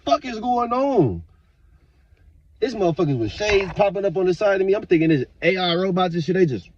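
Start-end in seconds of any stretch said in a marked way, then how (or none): 0.0-1.2 high, slow, baffled mock cry; a confused, drawn-out what-the-hell. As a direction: stretch it out in disbelief.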